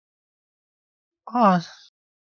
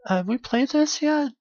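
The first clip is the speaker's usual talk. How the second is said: high question; 8 semitones higher than usual. Real, not acted.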